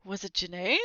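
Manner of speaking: ordinary delivery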